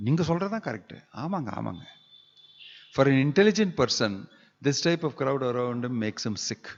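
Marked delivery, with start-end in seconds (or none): 0.1-1.8 higher, fawning agreement fading off; eagerly nodding along with the boss; flattering echo. Retold or imitated. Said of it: "who will always echo whatever you're saying".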